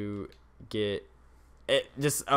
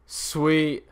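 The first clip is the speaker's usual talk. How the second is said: odd sweet; 4 semitones higher than usual. Real, not acted. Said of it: "I don't know why I said sweet about that".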